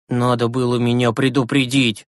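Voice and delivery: usual speaking voice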